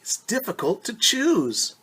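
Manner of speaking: put-on high girl's voice, a bit weary; sighing girl. A narrator's reading. Reading Rosa to the class, a girl's weary voice. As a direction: voice Rosa's sigh of indecision. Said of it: "Rosa sighed".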